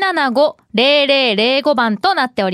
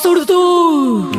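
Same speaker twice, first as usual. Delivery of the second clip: drawn-out, loud mock-menacing call; put-on tough voice, long and loud; menacing growl; 4 semitones higher, 3 dB louder, much slower than usual. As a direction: stretch it out with playful, heavy menace. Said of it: "今日はドスの効いた感じで".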